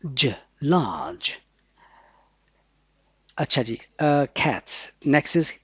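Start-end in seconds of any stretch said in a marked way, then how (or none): 0.1-1.3 very slow "large" after spelling; slow spelled out. Shown as a demonstration. Teacher spells and slowly says "large". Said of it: "L".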